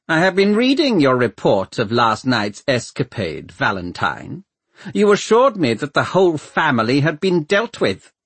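cold Lord Mayor, pitched high; a thin, high voice of icy authority. A narrator's reading. Lord Mayor interrupts to question Valentine's report, cold and thin. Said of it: "cold, thin voice of the Lord Mayor".

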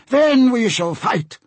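high, slow declaration; resolute captain. Acted. Captain vows to diplomat to fight, high voice.

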